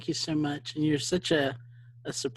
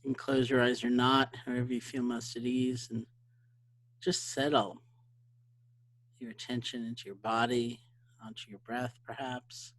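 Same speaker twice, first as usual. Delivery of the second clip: softer, unhurried meditation voice fading away; guided meditation; 3 dB quieter than usual. Acted. To the webinar audience, gently guiding a short sit. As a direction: ease them into settling with the breath.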